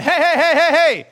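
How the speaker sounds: high, loud hey hey calls, like flashing a neon sign; calling out for attention. Retold or imitated. God calling out to his listeners, loud and high. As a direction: shout to grab their attention. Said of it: "He's going".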